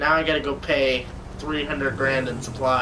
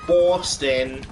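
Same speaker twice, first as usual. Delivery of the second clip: mock Boston accent; 4 dB louder than usual. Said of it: "I wish I could do a good Boston accent".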